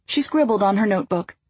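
plain reading voice

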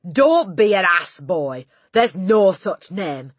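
loud, scornful scolding; Trunchbull. A narrator's reading. Headmistress disbelieving the boy's name, loud scorn. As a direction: dismiss it loudly and slowly.

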